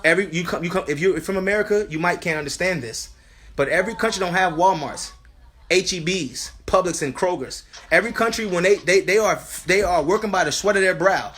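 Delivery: loud, high, emphatic sermon voice; forceful preaching. Real, not acted. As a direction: preach it hard, punching key words. Preaches forcefully about the markets.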